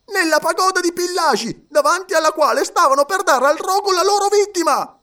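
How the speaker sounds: very high, loud indignant rush; excited outburst. A narrator's reading. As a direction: hurl the accusation back. Passepartout's outburst continues. (Passepartout indignato, acuto e forte)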